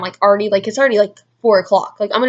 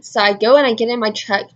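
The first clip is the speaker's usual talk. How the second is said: ordinary talk, nothing marked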